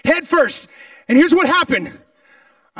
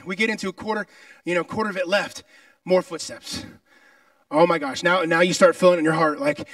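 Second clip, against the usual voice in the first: ordinary talk, nothing marked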